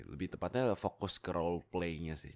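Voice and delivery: ordinary talk, nothing marked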